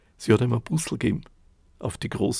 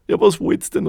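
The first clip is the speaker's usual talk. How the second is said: hoch und laut angefahren; geschrien; 8 semitones higher, 9 dB louder than usual. Acted. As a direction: schrei sie verzweifelt weg. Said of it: "hat er geschrien". (verzweifelt hoher Schrei)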